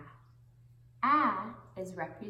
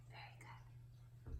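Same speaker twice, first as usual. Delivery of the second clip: whispered; 18 dB quieter than usual. Real, not acted. Praising children softly.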